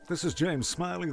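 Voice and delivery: ordinary talk, nothing marked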